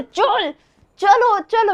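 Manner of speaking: loud impatient "come on" calls; urging shout. Retold or imitated. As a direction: hurry her along loudly. Husband dragging wife onto ride. (ज़ोर से "चलो, चलो" पुकारना)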